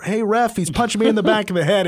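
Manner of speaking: whiny complaint to the ref. Retold or imitated. Fighter complaining to the ref mid-fight, mock whine. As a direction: imitate a fighter whining to the referee. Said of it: "Pierce starts complaining to the ref".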